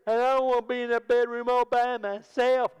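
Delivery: put-on high, loud child whine; complaining child voice; whiny kid. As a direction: beg not to sleep alone. Son pleading not alone.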